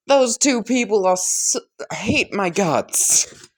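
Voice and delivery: high, sulky protest; whiny complaint. Acted. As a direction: moan it as the wronged one. All Might complains about being disliked.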